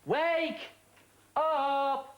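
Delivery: loud, high call to wake him; yelling across the room. Acted. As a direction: bellow it to rouse a sleeper. Waking a dozing guest.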